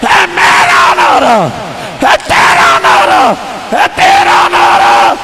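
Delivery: high, full-force chant shout; thunderous call to the crowd; roared slogan. Real, not acted. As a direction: belt out the rallying cry slowly. Roaring slogans after the hush.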